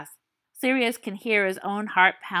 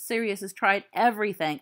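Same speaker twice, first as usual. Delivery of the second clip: usual speaking voice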